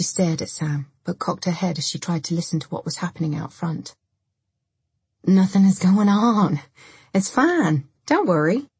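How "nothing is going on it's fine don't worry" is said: slightly raised, insistent denial; insistent reassurance. A narrator's reading. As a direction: insist that all is fine. Dennis insists nothing is happening.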